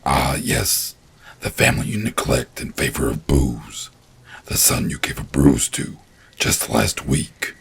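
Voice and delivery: low, cold creature voice; icy, unmoved monster. A narrator's reading. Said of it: "the creature said coldly and unmoved".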